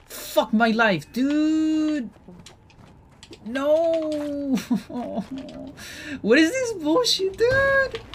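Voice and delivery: shrill tantrum over losing. Real, not acted. Raging after game death.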